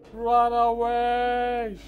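loud, slow shout of alarm. Real, not acted. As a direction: yell it as you flee. Fleeing in game.

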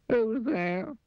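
high, squeaky little voice; sad squeaky voice. Acted. Unseen Mister Miserable answers a knock, sad and squeaky. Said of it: "Sad, squeaky sort of voice".

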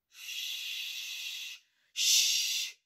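unvoiced hushing shh; calm the frightened shepherds.

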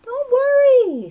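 high, loud, drawn-out sing-song reassurance; mother chirping reassurance at a worried child; cheery mom. A narrator's reading. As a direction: sing it out brightly to soothe her daughter. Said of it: "said her mother".

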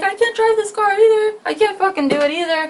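hammed-up high-pitched protest; mock high-pitched friend. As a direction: imitate him in a squeaky high voice. Imitating friend giggling high on mushrooms.

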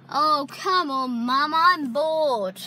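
loud, high, drawn-out complaint; impatient whine. Real, not acted. Bored child waiting in the car for mum, loud impatient whine. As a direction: drag it out, bored and fed up.

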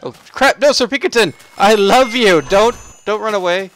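loud, high desperate yelling; panicked shout. Real, not acted. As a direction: shout after a fleeing pet. Pet running away.

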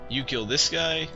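ordinary talk

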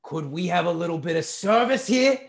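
shouted demand. A narrator's reading. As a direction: call out impatiently across the room. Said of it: "he shouted".

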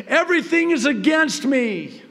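high, loud anguished cry with a laugh; intense despair. Retold or imitated. Grieving father crying that everything is against him, high and loud. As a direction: wail it out in despair. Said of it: "capture the intensity of it".

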